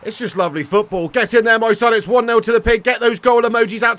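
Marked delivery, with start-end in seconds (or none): 1.1-4.0 loud, high cheering, louder and higher throughout; goal celebration. Real, not acted. Streamer celebrating his goal to chat, loud and elated. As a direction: roar it like you just scored.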